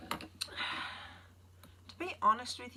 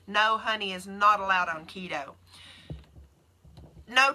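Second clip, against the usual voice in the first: normal talking voice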